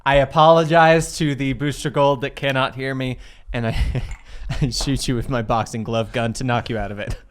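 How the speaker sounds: ordinary talk, nothing marked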